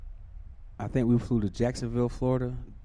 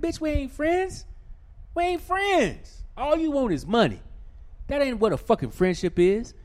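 high, loud, fed-up retort; venting to interviewer about hustlers wanting money; indignant rant; 5 dB louder than usual. Real, not acted. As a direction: push back hard on the fake friendship. Ranting about fake friendliness.

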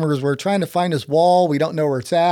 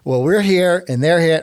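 ordinary talk

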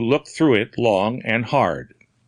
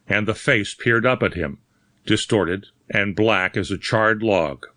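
ordinary delivery, nothing marked

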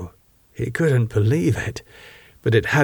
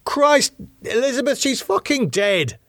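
exasperated outburst; 14 semitones higher than usual. A narrator's reading. David insisting to Elizabeth that Lydia is dead, exasperated. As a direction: protest in pained exasperation.

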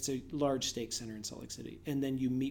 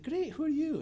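very high Scottish lady voice; squeaky put-on Scottish accent. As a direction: imitate a Scottish woman, pitched right up. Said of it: "there was a Scottish lady there".

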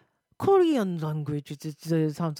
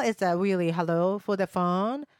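ordinary talk

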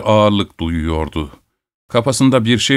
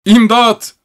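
very high, loud yell for help; cry for help; 13 semitones higher, 5 dB louder than usual. A narrator's reading. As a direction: scream it to wake the house. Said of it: "şiddetli bir sesle". (yüksek ve tiz imdat çığlığı)

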